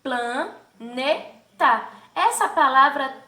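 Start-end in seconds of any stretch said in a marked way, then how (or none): 0.0-1.9 "Planeta" drawn out slowly, syllable by syllable. Shown as a demonstration. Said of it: "Olha como ficou a separação silábica".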